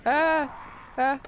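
short high startled shrieks; startled scream. Real, not acted. Player yelping at a sudden explosion. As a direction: yelp as something jumps you.

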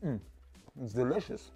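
ordinary delivery

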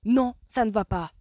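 roaring denial; thundered. A narrator's reading. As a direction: boom it out in anger. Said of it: "tonna-t-il".

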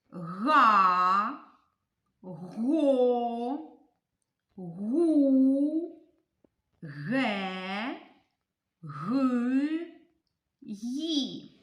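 put-on loud, slow, clear letter-by-letter reading for children; syllable drill. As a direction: chant the letters so the class can follow. Reads syllable column aloud. (голосне чітке читання літер для дітей)

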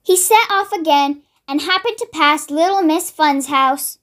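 plain reading voice

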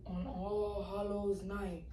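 whispered-low scary story narration; spooky storytelling. Acted. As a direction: hush it down like a campfire tale.

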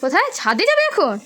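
bright-eyed parent quizzing, high and loud. Real, not acted. Questioning her small child.

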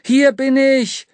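high, loud, slow call. Acted. Boy calls loudly to the approaching light. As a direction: shout to be found. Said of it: "rief der Junge".

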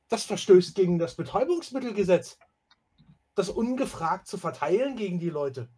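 high, loud indignant stickler; outraged protest. Acted. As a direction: cite the law in outrage. Erasmus protests it breaks the law.

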